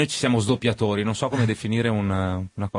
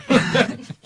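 high burst of laughter; 3 dB louder than usual. Real, not acted. Laughs at own admission.

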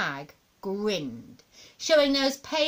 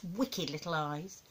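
ordinary delivery, nothing marked

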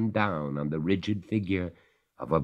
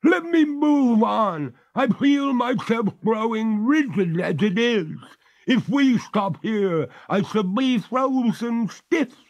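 freezing ghost; 7 dB louder than usual. Acted.

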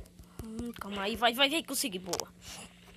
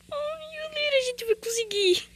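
high-pitched plea; 12 semitones higher than usual. Real, not acted. Tense moment in game.

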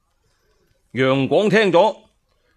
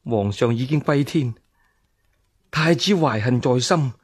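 resigned despair. Acted. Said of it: "陳夫人一狠心".

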